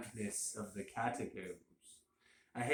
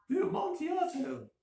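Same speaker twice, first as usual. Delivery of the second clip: shrill, much higher astonished cry; astonished exclamation; 4 dB louder than usual. A narrator's reading. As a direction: blurt it out in utter astonishment. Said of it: "ejaculated my friend".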